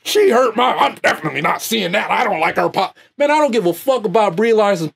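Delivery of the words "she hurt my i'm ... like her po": mocking whiny fan. Vlogger mocking fans, whiny put-on voice. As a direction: mimic a fan sulking about Brie Larson. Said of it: "how do you sound".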